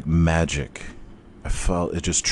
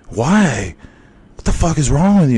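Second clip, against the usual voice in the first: louder, higher, fast exasperated outburst; exasperated wife; 8 semitones higher, 6 dB louder than usual. Retold or imitated. Wife yelling at her husband, exasperated. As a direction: snap at him in disbelief. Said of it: "And she's like".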